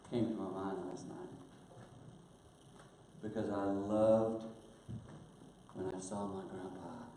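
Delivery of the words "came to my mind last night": ordinary talk, nothing marked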